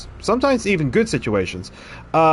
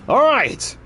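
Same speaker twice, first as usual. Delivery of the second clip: raised, regal "all right"; a haughty king, pitched up; lightly voiced king, unimpressed; 10 semitones higher than usual. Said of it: "I could do the voice".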